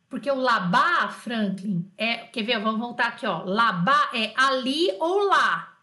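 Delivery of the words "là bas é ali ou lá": slow, loud, spelled-out teaching; emphatic definition. Shown as a demonstration. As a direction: stretch each key word for the class.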